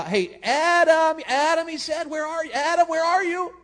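high, drawn-out call across the garden; God calling out. Pretend. God calling for the hiding Adam, long high call. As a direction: call his name long and high, searching.

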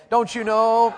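high, loud, emphatic challenge. Shown as a demonstration. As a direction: belt it out with conviction. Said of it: "Say it louder like you mean it".

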